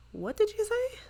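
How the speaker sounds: high-pitched incredulous question; shocked wife. Acted. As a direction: squeal it in mock disbelief. Voices shocked wife hearing news.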